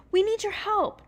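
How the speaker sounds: high, loud urgent plea; little girl crying out for help; cried out. Acted. Daphne pleading with Jack, high and loud. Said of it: "Daphne cried".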